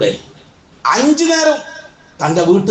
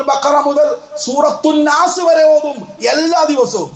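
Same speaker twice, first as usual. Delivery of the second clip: higher, impassioned sermon delivery; fervent preaching; 5 semitones higher than usual. Acted. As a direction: rouse the congregation with mounting fervor. Preaching at full force.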